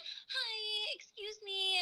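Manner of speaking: high, sugary "hi, excuse me"; charming sweet voice. Retold or imitated. Filmmaker charms the neighbor into quieting down, high sweet voice. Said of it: "work my charm".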